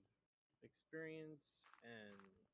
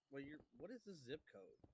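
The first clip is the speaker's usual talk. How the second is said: usual speaking voice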